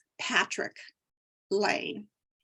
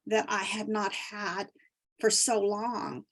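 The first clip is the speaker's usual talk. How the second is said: usual speaking voice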